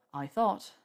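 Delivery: plain reading voice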